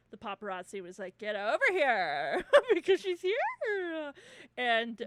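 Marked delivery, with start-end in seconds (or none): 1.2-4.1 loud, drawn-out mock summons; calling out. Roommate calling the paparazzi over, calling out. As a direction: holler slowly as if beckoning a crowd.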